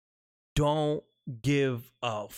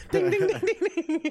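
bell ding imitation; 3 dB louder, much slower than usual. Ringing a crier's bell.

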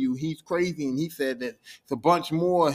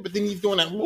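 ordinary talk, nothing marked

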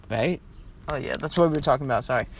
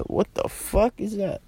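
mock disbelief; 6 semitones higher, slower than usual. Retelling neighbor's odd look.

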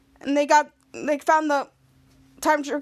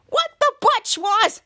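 indignant squeak; 7 semitones higher, 3 dB louder, slower than usual. Retold or imitated. Indignant after being hit.

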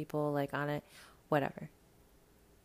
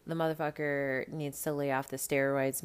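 ordinary talk, nothing marked

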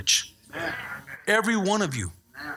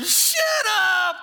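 high, loud exasperated yell; mock angry shout; 12 semitones higher, 4 dB louder than usual. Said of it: "If I were God, I'd be going".

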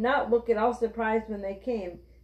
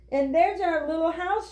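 higher, eager call at ordinary volume; understated excited shout; mild storybook shout; 7 semitones higher than usual. A narrator's reading. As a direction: point it out with lifted pitch. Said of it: "shouted Benny".

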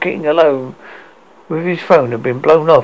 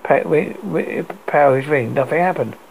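ordinary delivery

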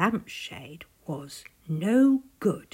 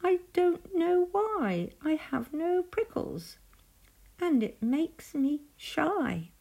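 high, small, mildly forlorn voice; understated storybook woe; shy sigh; 8 semitones higher than usual. A narrator's reading. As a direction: confess it bashfully in a little high voice. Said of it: "said with a sigh".

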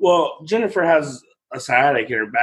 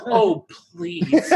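much higher mock begging through a laugh; hammed-up plea; 11 semitones higher than usual. Father mimics his daughter's plea, higher and laughing. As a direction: overdo the little girl's please while laughing. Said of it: "She didn't say the please".

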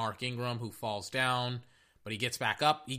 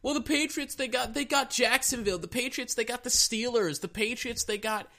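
high, sing-song mockery of the doubters; high mocking fan voice; 10 semitones higher than usual. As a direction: parrot the know-it-all fans in a high silly voice. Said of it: "Everybody's like".

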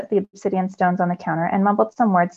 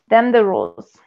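skeleton voice; 4 dB louder than usual. A narrator's reading. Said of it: "with a deep, raspy voice".

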